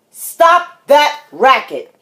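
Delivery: loud, slow, word-by-word order; stern, spaced-out command; dad scolding. Acted. Dad scolds noisy child.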